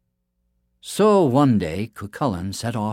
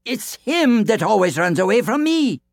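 loud boast; 14 semitones higher, 6 dB louder than usual. A narrator's reading. Giant boasting loudly.

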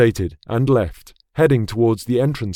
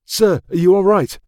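higher, urgent soldier voice; concerned soldier; 7 semitones higher, 4 dB louder than usual. Acted. Soldier asking the prince, concerned. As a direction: check on him with alarm.

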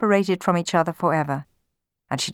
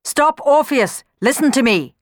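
loud, high, commanding call; ringing command; 8 semitones higher, 6 dB louder than usual. A narrator's reading. Amor stops Orpheus stabbing himself, ringing command. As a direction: ring it out to halt him. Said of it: "a voice rang out".

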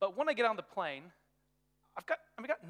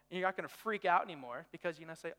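usual speaking voice

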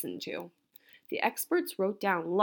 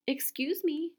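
higher, cheerful little greeting; brightly; 7 semitones higher than usual. A narrator's reading. Old woman at the front door, bright higher greeting. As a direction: pipe up politely and cheerfully. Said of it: "she said brightly".

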